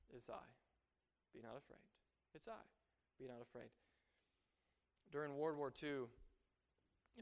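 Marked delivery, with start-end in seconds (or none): 0.1-3.7 very soft, low reassurance; gentle, quiet voice of God; still small voice. Acted. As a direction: hush it right down, leaving space between phrases. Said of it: "your still small voice".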